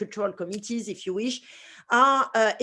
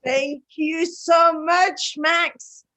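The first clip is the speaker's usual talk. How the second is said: excited thanks; 7 semitones higher, 5 dB louder, much slower than usual. Real, not acted. Opening thanks after introduction.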